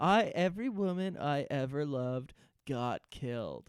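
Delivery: mock tragic. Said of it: "mock Saeed confession".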